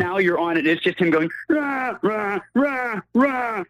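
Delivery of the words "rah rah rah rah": mock Wookiee yelling, lightly done; high mock growls; Wookiee roar. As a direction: imitate Chewy roaring. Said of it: "Just screaming at you".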